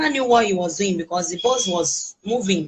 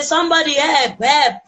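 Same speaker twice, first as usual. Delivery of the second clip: calling for help, pitch jumping up and a little louder; retold shout for help; 6 semitones higher, 4 dB louder than usual. Tied-up woman calling out for rescue, a mild mock yell. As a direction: recreate the panicked cry without a full scream. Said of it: "from shouting that help".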